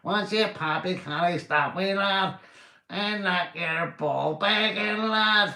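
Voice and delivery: high, squeaky Belfast lad; hammed-up Belfast accent, pitched right up. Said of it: "We Belfast accent there".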